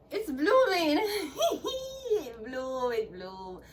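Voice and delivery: giddy laugh cracking into tears; laughing with joy. Real, not acted. Showing viewers her blooming flowers, teary giddy laugh. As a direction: laugh and half-cry from sheer joy.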